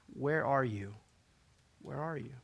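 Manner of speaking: God's hushed call, fading on the repeat; soft calling. Acted. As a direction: call softly and low, leaving space. God calls softly to Adam, Eve.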